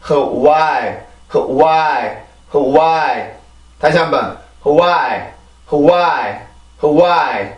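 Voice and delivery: 흐와이 repeated with a breathy H and falling tune; breathy hwai drill. Shown as a demonstration. Teacher drills students on 흐와이. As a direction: chant 흐와이 steadily for repetition. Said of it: "이렇게 발음을 해주세요".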